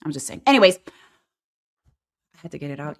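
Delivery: ordinary delivery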